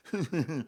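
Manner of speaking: forced laugh through gritted teeth; fake chuckle. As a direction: imitate an unamused man's strained chuckle. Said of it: "it's a kind of fake laughter".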